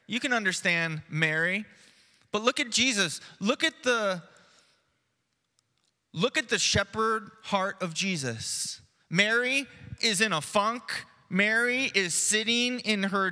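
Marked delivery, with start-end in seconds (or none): none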